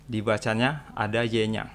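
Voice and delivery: ordinary delivery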